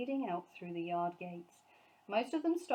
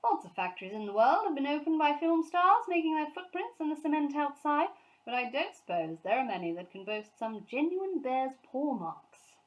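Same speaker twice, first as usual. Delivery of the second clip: much higher, louder public address; jovial speech; 7 semitones higher, 7 dB louder than usual. A narrator's reading. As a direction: address the crowd grandly. Sir Huntley's speech to crowd.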